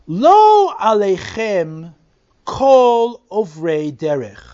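slow, measured verse reading; scripture reading. Shown as a demonstration. Teacher reading Lamentations aloud to the class, slow and measured. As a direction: read the pasuk slowly and deliberately.

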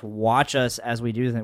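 usual speaking voice

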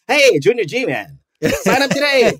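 put-on old-timey newsreel announcer voice; slow, high vintage radio pitchman; 1950s narrator. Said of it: "excuse my nineteen thirties impression voice".

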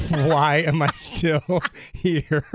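drawn-out question broken by laughter; laughing mock regret. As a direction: laugh through it, slowly, in mock exasperation. Laughing at own retold regret.

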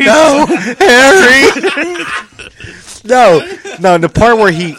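high, loud, drawn-out protest through laughter; laughing too hard to get it out. Real, not acted. Correcting friends about the movie scene, laughing hard.

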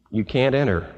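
ordinary talk

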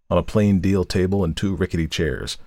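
plain reading voice

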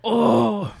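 loud moaning groan. Retold or imitated. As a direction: groan like it's painful. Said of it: "she would go".

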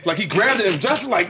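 usual speaking voice

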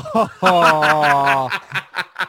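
rire fort, montant, étonné; éclater de rire, pris au dépourvu; grand éclat de rire. Real, not acted. Éclate de rire après le « quarante ». (gros rire surpris)

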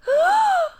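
sharp high gasp; high theatrical gasp; mock gasp.

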